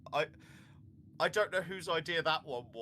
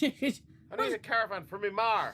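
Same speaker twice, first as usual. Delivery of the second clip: mock Irish traveller; 6 semitones higher, 5 dB louder than usual. Mock traveller colonist line.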